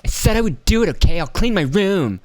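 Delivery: put-on high, exasperated whine of a nagged kid; mock a teenager fed up with their mother; bratty kid impression. Kid told off by mom, exasperated mock whine. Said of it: "That's how she sounded".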